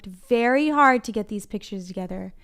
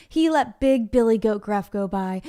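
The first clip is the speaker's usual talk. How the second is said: ordinary delivery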